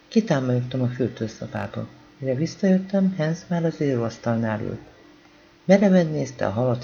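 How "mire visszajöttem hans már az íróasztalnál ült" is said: plain reading voice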